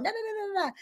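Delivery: high, hushed repeated no; accuser's voice. Retold or imitated. The accuser of the brethren whispering doubt, high and hushed.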